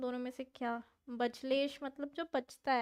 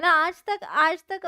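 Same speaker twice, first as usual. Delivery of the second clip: shouted "aaj tak" with emphasis; loud, insistent protest; loud exclamation; 4 semitones higher, 11 dB louder than usual. Real, not acted. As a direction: belt it out indignantly. Emphatic outburst to chat.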